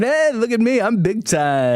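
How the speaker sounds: high showy hey look at me; mock bragging. Dad showing off during trick-or-treat, mock brag. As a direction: puff yourself up. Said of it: "And I'm over here going".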